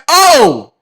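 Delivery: high, shouted mock alarm; loud shocked realisation. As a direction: blurt it out high and loud, hammed-up. Acted shocked realisation of the danger.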